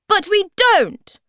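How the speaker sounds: very high, loud shouted protest; shouting. A narrator's reading. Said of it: "shouted Sniff".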